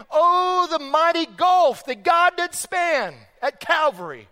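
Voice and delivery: high, impassioned sermon climax; preaching. Acted. Pastor proclaiming Christ's sacrifice to the congregation, impassioned preaching.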